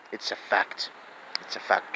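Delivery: muttered aside. Real, not acted. Muttered aside after drug point.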